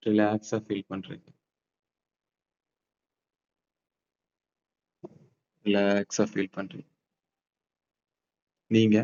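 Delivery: slow, soft meditation voice; guided meditation. Acted. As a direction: paint the calm scene slowly. Guided visualization in park.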